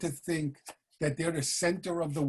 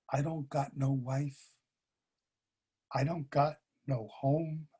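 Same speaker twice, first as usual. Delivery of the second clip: put-on softer and slow, a drunk near tears; quiet, ashamed sorrow; 2 semitones lower than usual. Drunk tells the old man his wife died, quiet near tears. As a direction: let it sink, close to crying. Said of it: "And he starts to cry".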